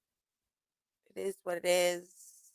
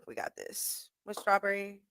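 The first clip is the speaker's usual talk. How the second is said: whispered aside; 10 dB quieter than usual. Real, not acted. Aside about funnel cake.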